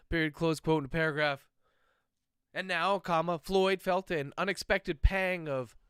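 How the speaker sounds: ordinary delivery, nothing marked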